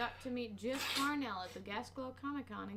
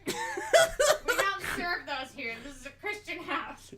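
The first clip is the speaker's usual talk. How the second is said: mock stern host; 6 semitones higher, 9 dB louder, slower than usual. Mock strict host reply.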